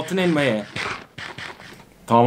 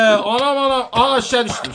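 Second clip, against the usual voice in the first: usual speaking voice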